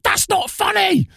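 very high, slow shouted protest; mock outrage. Seething singer to prankster, high mock outraged shout. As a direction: drag it out as an indignant squeal.

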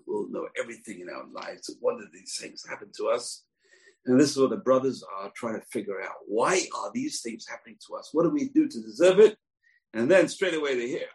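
normal talking voice

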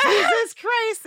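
loud, high, slow exasperated cry. Retold or imitated. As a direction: drag it out in disbelief. Retold exasperation.

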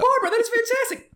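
squeaky, loud mock-enthusiastic praise; high mock praise. Friend quoting a movie, high gushing praise. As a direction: gush over Barbara in a high voice. Acting out zombie training scene.

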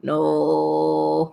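long drawn-out mock wail; plaintive wail. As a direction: wail it as a shut-in cat. Cat shut behind a door.

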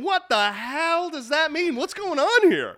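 loud, high mock confusion; mock bewilderment. Podcast host voicing listeners puzzled by the name, mock bewilderment. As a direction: voice a baffled listener, exaggerated.

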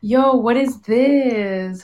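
usual speaking voice

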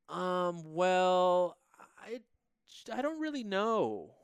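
high-pitched imitation of hesitant uncertainty; a little nervous. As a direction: mimic someone unsure, stalling before answering. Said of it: "she's just a little nervous".

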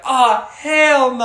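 loud, high, drawn-out yell; retold scream. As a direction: scream it in comic panic, stretching the words. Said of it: "I screamed".

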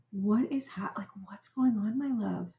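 put-on tender whisper to an upset child; whispered comfort. Shown as a demonstration. Parent coaxing an angry child, in a whisper. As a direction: hush right down, coaxing. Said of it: "what I teach parents to do is say".

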